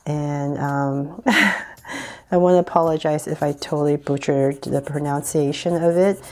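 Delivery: normal talking voice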